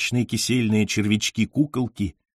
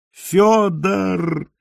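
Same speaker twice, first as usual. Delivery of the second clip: high, long-drawn howl of awe; trembling howl; 9 semitones higher than usual. A narrator's reading. Admirer cries out to the poet, a high awestruck howl. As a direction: wail the name imploringly. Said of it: "взвыл я трепеща".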